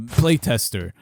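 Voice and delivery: normal talking voice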